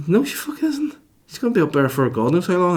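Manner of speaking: high-pitched, exasperated mock child; indignant retort. Child to dad about absent mum, indignant.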